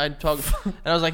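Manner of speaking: usual speaking voice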